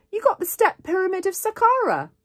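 high, loud gush; overexcited. A narrator's reading. Girl psyching her friend up about his assignment, overexcited. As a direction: hype it up with too much enthusiasm. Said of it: "maybe a little overexcited".

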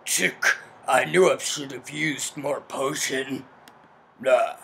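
very low, throaty sinister figure; deep mystery voice. Acted. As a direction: drop deep into the throat and mutter like a villain. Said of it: "let's deep throat that voice up".

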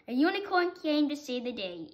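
ordinary delivery, nothing marked

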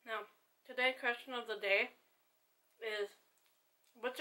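slightly muffled food in mouth; speaking while chewing. Real, not acted. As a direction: hand at mouth. Seen 0: Hand pinched at chin by mouth; bit food shortly before, lips work.

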